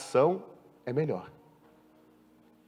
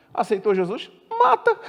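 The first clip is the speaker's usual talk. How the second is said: mock squeal through laughter; laughing mockery; 8 semitones higher, 6 dB louder than usual. Preacher mocks harsh believers while laughing, mock squeal. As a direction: laugh while parodying, mata squeaked high.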